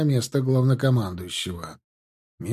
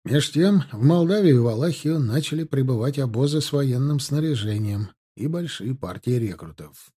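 ordinary delivery, nothing marked

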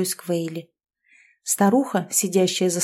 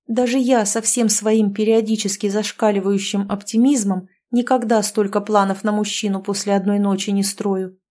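usual speaking voice

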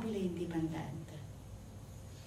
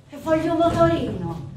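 put-on high, loud teenage demand; insistent demand; 6 semitones higher, 8 dB louder than usual. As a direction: demand it like a stubborn girl. Girl insists in acted quarrel.

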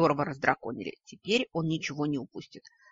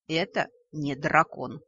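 plain reading voice

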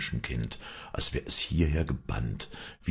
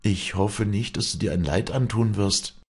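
higher, worried urging; mildly anxious appeal; alarmed priest; 7 semitones higher, 4 dB louder than usual. A narrator's reading. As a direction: voice concern for her life. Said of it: "rief der Geistliche beunruhigt".

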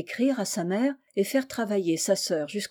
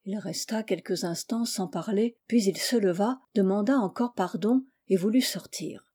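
plain reading voice

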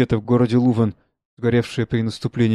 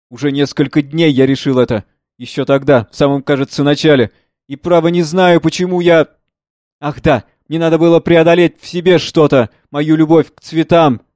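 lightly voiced old father; 4 semitones higher, 8 dB louder than usual. Father confesses his decision.

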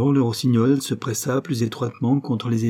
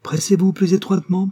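higher, louder cry; 8 semitones higher, 5 dB louder than usual. A narrator's reading. The rose cries to the nightingale, a loud high call. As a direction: call out urgently to the bird. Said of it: "la rose cria au rossignol".

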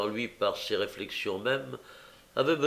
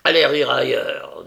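loud contemptuous dismissal; scornful loud order; disdainful; 2 semitones higher, 10 dB louder than usual. A narrator's reading. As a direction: brush him off with scorn. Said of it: "en toisant le juif avec dédain".

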